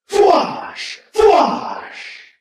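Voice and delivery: volley of high, whooshing laser shots; rifle blast sounds. A narrator's reading. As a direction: mouth separate energy-gun blasts. Narrator voices rifle blast sound effects.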